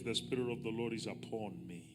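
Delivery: normal talking voice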